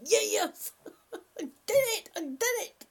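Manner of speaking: high, laughing pretend cheer; mock celebration. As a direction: celebrate as if jumping up and down. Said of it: "jump up and down like".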